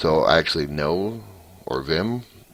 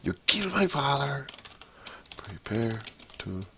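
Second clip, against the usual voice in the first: poor Inigo Montoya impression; 9 semitones higher, 4 dB quieter than usual. Typing coder acts out the revenge line, high mock voice. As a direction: threaten like a mock swordsman. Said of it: "very poor impression of him".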